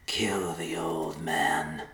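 slow, eerie whisper; slow voice inside his head. A narrator's reading. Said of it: "a voice said in the messenger's mind".

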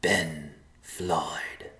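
slow, eerie whisper. A narrator's reading. The voice gives the messenger a name, whispering. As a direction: whisper it like a voice inside the head. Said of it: "the voice whispered".

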